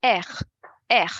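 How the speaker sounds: Er, er rasped from the throat; French R demo. Shown as a demonstration. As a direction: growl Er, er between R and K. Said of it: "between R and K".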